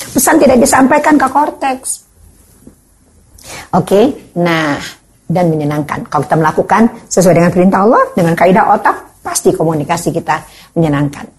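usual speaking voice

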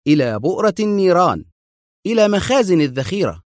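high, resolute answer; firm. A narrator's reading. Adham answering Mona about their target, firm. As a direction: answer firmly, no doubt. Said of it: "أجابها في حزم".

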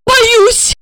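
very high, fearful squeak; frightened. A narrator's reading. Woman too scared to go on, frightened squeak. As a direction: squeak out the fear.

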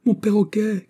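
put-on very high, distressed child's cry. A narrator's reading. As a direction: cry out for a lost pet. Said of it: "criai-je".

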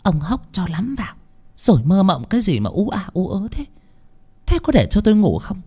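a wife grumbling at her husband at night; hushed snap. Acted. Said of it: "Bà cả Lương khẽ gắt".

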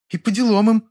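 high-pitched voice with sudden spite; mock female voice, spiteful; spiteful snort. A narrator's reading. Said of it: "неожиданно зло фыркнула Лёля".

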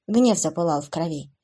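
ordinary delivery

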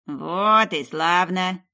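loud, very slow crone; satisfied witch. A narrator's reading. Witch pleased with the beetle, loud and slow. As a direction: drawl out triumph.

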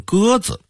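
"鸽子" very high, with full zi ending; Hong Kong-Taiwan pronunciation. Shown as a demonstration. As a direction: say "鸽子" high, zi replacing the Beijing er ending. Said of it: "非得说成".

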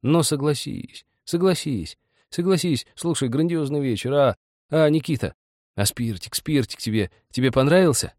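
high-pitched, eager repetition; embarrassed babbling. A narrator's reading. Ilyusha presses Nikita about the evening, embarrassed babble. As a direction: coax him for agreement, tripping over repeats. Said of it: "начинал как-то приборматывать, повторяя слова".

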